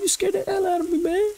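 mock your own startled squeak on purpose; cartoonish high squeal, played for laughs; exaggerated squeaky voice. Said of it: "Why am I talking like that?".

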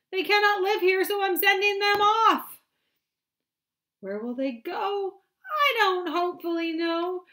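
high, loud, slow mournful Lorax; sad Lorax. Acted. Lorax tells the Once-ler he sends the animals off, high and loud. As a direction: plead as he sends them off. Lorax voiced reading aloud.

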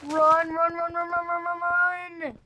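panicked run shouts; yelling while running away. Real, not acted. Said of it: "fleeing an attacker".

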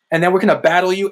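normal talking voice

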